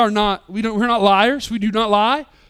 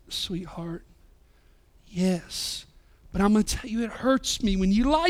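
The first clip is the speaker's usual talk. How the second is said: soft reassurance; 4 dB quieter than usual. Retold or imitated. Dad reassuring crying daughter.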